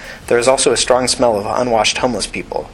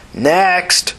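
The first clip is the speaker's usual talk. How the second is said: raised call for the next person; 8 semitones higher than usual. Acted. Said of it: "the nurse called".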